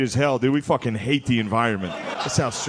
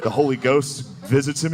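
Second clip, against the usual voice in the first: usual speaking voice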